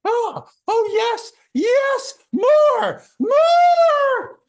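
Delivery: high, loud ecstatic cries; passionate kiss. Shown as a demonstration. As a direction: cry out in hammed-up passion. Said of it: "reach in to try to kiss them".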